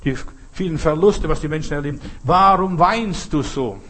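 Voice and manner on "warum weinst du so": slow, raised, drawn-out question; emphatic plea. Real, not acted. Preacher putting Mary's question to the congregation, slow heartfelt plea. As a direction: press the question slowly, pleading.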